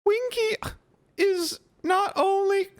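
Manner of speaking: very high, offended elf squeak with a sob; tipsy house-elf defending her master, indignant. Acted. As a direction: squeak it out, wounded pride. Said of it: "Winky squeaked indignantly".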